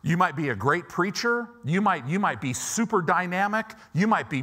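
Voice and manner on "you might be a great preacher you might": usual speaking voice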